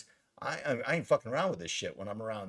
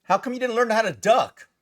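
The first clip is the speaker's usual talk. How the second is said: much higher and louder, mock-innocent; cheeky question; 11 semitones higher, 10 dB louder than usual. Actor teasing his much-shot captain, cheeky question. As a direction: ask it loud and high, teasing.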